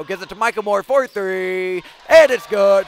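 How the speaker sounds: rising to a big shout; excited call. Real, not acted. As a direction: call the made three with a burst. Made three-pointer call.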